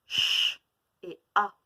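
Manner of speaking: ch soufflé puis a, bien détachés; décomposer ch et a en deux sons nets; sons séparés. Shown as a demonstration. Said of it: "je vous décompose le mot en sons". (sons détachés)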